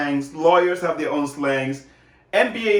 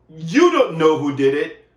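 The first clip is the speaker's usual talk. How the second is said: ordinary talk, nothing marked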